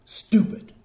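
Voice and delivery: contempt in one word, barely voiced; demonstrated scornful put-down; contemptuous. Shown as a demonstration. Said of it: "I say it like that".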